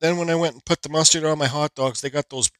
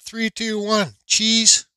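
camera countdown; 7 semitones higher, much slower than usual. Real, not acted. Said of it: "gonna do this for a screenshot".

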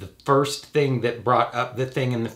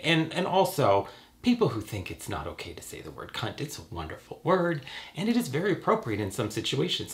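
ordinary delivery

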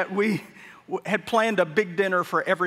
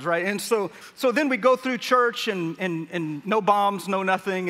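ordinary talk, nothing marked

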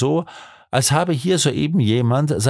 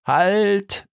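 called out; 10 semitones higher than usual. A narrator's reading. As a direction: call it out high, as a narrated halt command. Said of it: "rief er".

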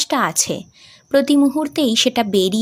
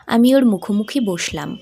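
ordinary delivery

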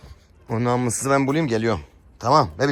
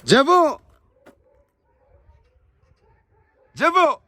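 calling out; slower than usual. Real, not acted. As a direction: shout the pet's name to carry far. Calling lost pet from afar.

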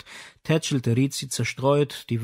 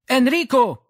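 high loud call up the stairs; a mother calling her son upstairs; 12 semitones higher, 6 dB louder than usual. Acted. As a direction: shout the name from below.

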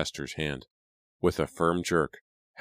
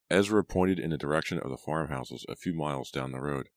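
ordinary delivery

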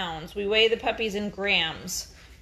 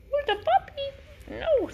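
much higher, slow, cooing pet voice; slower than usual. Real, not acted. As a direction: stretch it out in a tiny cooing voice. Repeats it to the dog.

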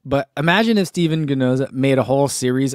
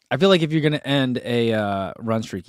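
usual speaking voice

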